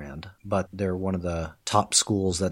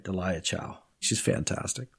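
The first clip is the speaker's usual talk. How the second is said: usual speaking voice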